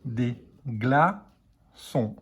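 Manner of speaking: slow, stretched; careful dictation pace. Shown as a demonstration. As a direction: dictate "Des glaçons" slowly, gla-çons. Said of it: "c'est le mot entier qu'il faut écrire".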